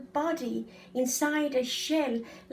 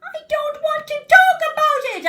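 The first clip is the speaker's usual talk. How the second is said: full roar, much higher and louder; 14 dB louder, faster than usual. Acted. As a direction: roar it like a lion. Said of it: "I roared".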